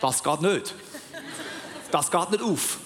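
mock Swiss German accent, gat nöt; playful Swiss German accent, with a laugh. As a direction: voice a stern Swiss local refusing in Swiss German accent. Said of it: "Was sagt dann der Eidgenosse?".

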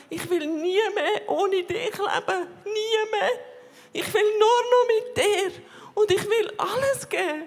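surrendering to Jesus, crying and laughing at once; laughing through tears. Real, not acted. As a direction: let the tears and joy break through. Tearful prayer of surrender.

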